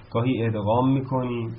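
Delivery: usual speaking voice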